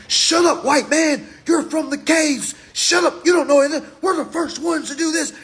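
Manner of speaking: high, loud mock rant; mock shouting. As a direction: ham up a shouting argument.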